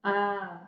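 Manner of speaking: short u sound Uh said alone; isolated vowel. Shown as a demonstration. Teacher isolating the short u for pupils. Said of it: "let's take a look at that vowel".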